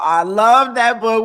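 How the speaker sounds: loud, slow, drawn-out love; gushing. Real, not acted. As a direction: savour every word. Gushing about favorite book.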